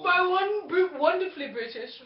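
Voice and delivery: lightly put-on British accent. Podcaster echoes the British sheep, playful British accent. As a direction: try a bit of British, playfully. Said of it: "I would do a little bit of a British accent".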